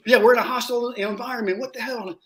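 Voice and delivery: high-pitched, mocking outrage; exasperated rant. Gunny ranting at his men about the hostile environment. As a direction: rant at them in disbelief. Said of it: "So I lose, I lose my mind on them".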